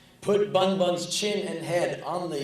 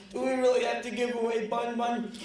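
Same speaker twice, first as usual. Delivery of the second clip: put-on pleading kid voice; 9 semitones higher than usual.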